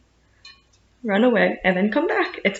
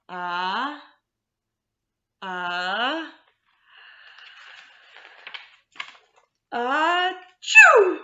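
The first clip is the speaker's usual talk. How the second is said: big mock sneeze; 3 semitones higher, much slower than usual. A narrator's reading. Bear with a tickly nose, building hammed-up sneeze. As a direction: wind up the sneeze and let it burst.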